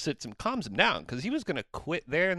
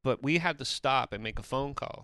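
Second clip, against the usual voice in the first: normal talking voice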